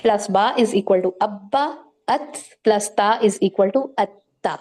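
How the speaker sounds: "ابا" and "اتا" spelled out slowly, letters doubled. Shown as a demonstration. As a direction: build each word slowly, pressing the doubled letter. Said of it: "مجھ پہ لازم ہے میں اس کو زور دوں".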